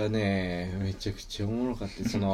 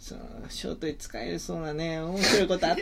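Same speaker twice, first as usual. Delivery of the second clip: imitating the auntie; 6 semitones higher than usual.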